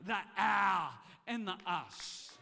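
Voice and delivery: a loud shout that drops off; fiery preacher's shout; pulpit shout. Real, not acted. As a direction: belt it to the congregation.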